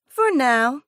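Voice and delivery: bright chirpy caller voice. A narrator's reading. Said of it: "Blakely chirps pleasantly".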